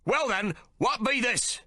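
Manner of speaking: high challenging shout; Stubbs shouts. A narrator's reading. First mate holding up the axe, high shout. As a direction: throw the question at the crew. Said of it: "Stubbs shouts".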